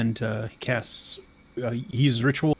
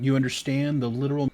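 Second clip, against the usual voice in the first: ordinary delivery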